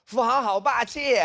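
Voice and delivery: high, slow swooning cry; hammed-up squeal of admiration; mock adoring fan. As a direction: gush like a smitten fan. Acted admiring fan's cry.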